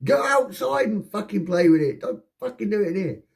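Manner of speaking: very high, strained yelling; acted-out angry shout; retold shout. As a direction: yell it like an exasperated housemate. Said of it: "So I shouted at him".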